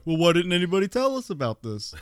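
high, laughing mock outrage; mock outraged public. Host mocks how everyone will react, high and laughing. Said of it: "Everybody's gonna be like".

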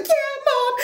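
daughter. Retold or imitated. Daughter teasing mom with pillow, high and playful.

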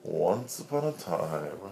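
slow storybook opening; fairy tale opening. A narrator's reading. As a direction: start it like a bedtime tale. Restarts the bedtime story.

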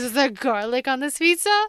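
hamming up the count's suspicion; mock Dracula. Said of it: "He goes".

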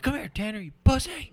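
yelling coach. Retold or imitated. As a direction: bark it like a mean coach. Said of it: "He's like".

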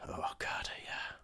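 breathy unvoiced whisper; hushed whispered pleasure at the smell. Real, not acted. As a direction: whisper it in delight. Whispered over smelling hops.

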